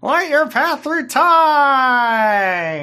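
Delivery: very high, loud, extremely drawn-out chant; hammed-up echo. Co-host echoing the mock prayer, over-the-top blessing voice. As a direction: belt it out, stretching the last word.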